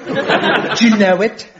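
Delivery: slow, badly faked English accent; mock English, shifting about; wandering English accent. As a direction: bluff it in phoney, drawn-out English. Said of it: "this unique accent, which keeps changing".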